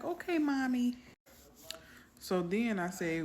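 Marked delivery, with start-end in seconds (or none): 0.0-1.0 high childlike imitation of a four-year-old; little girl voice. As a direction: play the small daughter answering sweetly. Mother voices little daughter.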